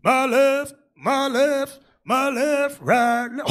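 high, lightly hammed marching call; mock drill cadence. Imitating the training cadence for the congregation, mock drill call. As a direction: bark out the cadence like a drill leader.

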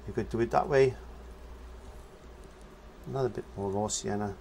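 ordinary talk

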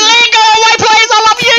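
high, screamed begging; shrieking, desperate gamer plea; panicked pleading. Real, not acted. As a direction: beg frantically for your life. Begging the killer to leave.

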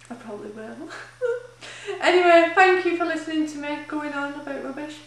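crying through a laugh; choked-up, laughing through real tears; tearful. Real, not acted. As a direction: let the tears break in as you thank them. Tearful at the end of her vlog.

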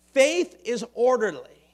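ordinary talk

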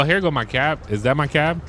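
ordinary delivery

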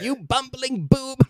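Skeletor sneer. Retold or imitated. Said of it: "He's like".

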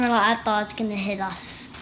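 plain reading voice

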